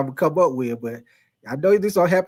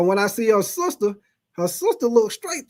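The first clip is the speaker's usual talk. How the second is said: ordinary talk, nothing marked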